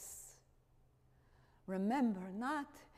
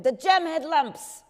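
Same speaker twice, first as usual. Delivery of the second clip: higher, louder recited answer; dutiful child answer; 6 semitones higher, 12 dB louder than usual. Retold or imitated. Miep answering Otto like a scolded pupil, higher and louder.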